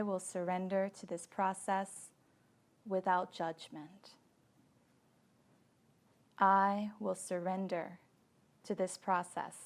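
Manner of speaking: calm Yoga Nidra leading, phrase by phrase; guided meditation. Acted. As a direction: guide them gently into deep relaxation. Leads guided relaxation practice.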